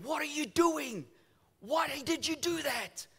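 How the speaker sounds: high-pitched angry shout, retold. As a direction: shout it high, acting out an outraged driver. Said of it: "he starts shouting".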